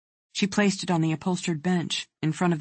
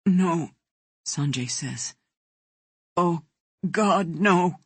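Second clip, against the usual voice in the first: wretched sobbing; slower than usual. A narrator's reading. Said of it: "becomes a wretched sobbing".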